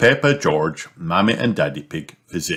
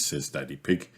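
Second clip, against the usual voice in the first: plain reading voice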